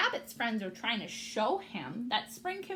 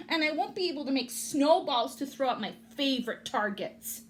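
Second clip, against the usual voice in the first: grumpy rabbit; 5 semitones higher, 6 dB louder than usual. Acted. Rabbit complains.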